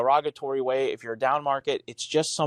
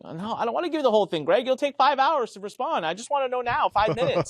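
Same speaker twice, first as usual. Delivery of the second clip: higher, fast, impatient pleading at Greg; impatient begging; 9 semitones higher than usual. Retold or imitated. Pushing Greg for a quick answer, impatient and high. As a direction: rush him, you want the answer now, not in five hours. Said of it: "He used to piss me off".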